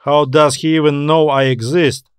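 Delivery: ordinary delivery, nothing marked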